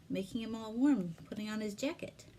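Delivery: ordinary delivery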